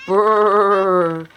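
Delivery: loud shivering brrr. Acted. Reading about penguins, a loud cold shiver. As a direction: shiver as if freezing.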